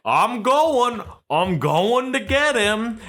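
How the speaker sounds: mock yokel promising on the phone.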